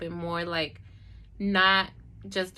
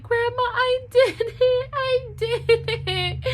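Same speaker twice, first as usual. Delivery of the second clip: much higher, louder, slow sobbing cry; 6 dB louder, slower than usual. Retold or imitated. Telling grandma at the door she enrolled, crying out high and loud. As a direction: burst out through tears, voice cracking high. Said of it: "I cried, and I was like".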